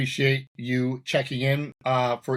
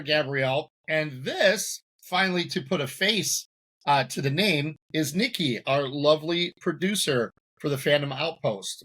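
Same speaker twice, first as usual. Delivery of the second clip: normal talking voice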